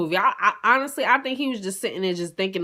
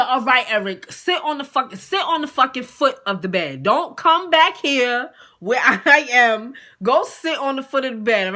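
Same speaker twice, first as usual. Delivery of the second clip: retold exasperated order, raised and louder in bursts; 5 semitones higher, 6 dB louder than usual. Woman ordering her guest to the foot of the bed, exasperated. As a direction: lay down the law, voice climbing.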